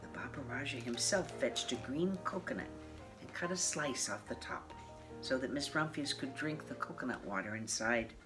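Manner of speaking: ordinary delivery, nothing marked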